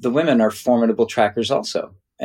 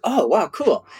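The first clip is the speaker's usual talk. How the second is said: higher, retold wowed reaction; impressed delight; 8 semitones higher than usual. Visitor invited over to the ladies' fire, impressed delight. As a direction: lightly act out being impressed, pitched up.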